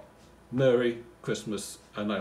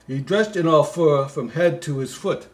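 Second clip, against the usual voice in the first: reads the Christmas poem aloud, a bit louder; poem reading; 4 semitones higher, 7 dB louder than usual. Acted. Comic reader reciting the Christmas poem, lightly sing-song. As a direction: recite the verse with a touch of storybook lilt.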